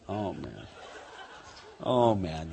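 imitating a worn-out Jesus; exasperated Jesus. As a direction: sigh it out, fed up. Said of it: "you can just imagine Jesus going".

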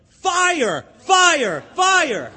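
full-voiced, high alarm yell; very loud yelling. Preacher imitates Wesley waking a dozing churchgoer, very loud yelling. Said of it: "in a very loud voice, Wesley starts yelling".

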